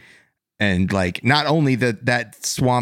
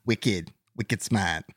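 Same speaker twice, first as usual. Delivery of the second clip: slow, softer mock Boston accent; 5 dB quieter, slower than usual. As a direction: savour the Boston slang in accent. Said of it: "does anybody wanna do a wicked".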